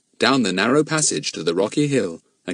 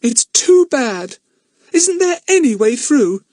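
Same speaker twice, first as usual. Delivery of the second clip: put-on high, slow girl's voice near tears; plead, close to crying; girl nearly in tears at the wall; tearful girl; 7 dB louder, slower than usual. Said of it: "almost in tears".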